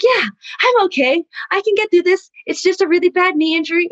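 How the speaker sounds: high-pitched retold insistence on being fine; holding it together. Injured daughter assures crying mom, holding it together. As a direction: imitate putting on a composed front. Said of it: "I was holding it".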